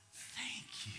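breathy whispered thank-you. Retold or imitated. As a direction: barely whisper it, moved. Clerk's tearful quiet thanks.

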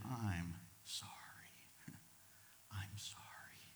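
slow, whispered sorry; soft apology. Shown as a demonstration.

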